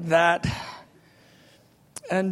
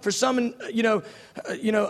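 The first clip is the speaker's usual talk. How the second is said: usual speaking voice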